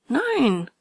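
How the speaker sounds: Nein firmly denied, falling; stubborn denial. A narrator's reading. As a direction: deny it stubbornly. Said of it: "Immer hitziger ward der Streit". (trotziges Nein)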